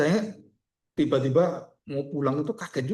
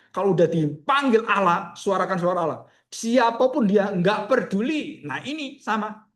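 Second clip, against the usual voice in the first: raised, emphatic pulpit voice; impassioned preaching; 6 semitones higher, 5 dB louder than usual. Real, not acted. Preacher urging the congregation to voice God's truth, impassioned. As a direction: press the point on the congregation with force.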